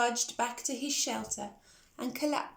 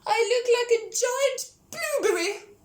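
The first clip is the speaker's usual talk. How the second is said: much higher, louder, crying outburst; blurted, tearful; 11 semitones higher, 8 dB louder than usual. Acted. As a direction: blurt it out near tears. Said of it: "blurted Pig".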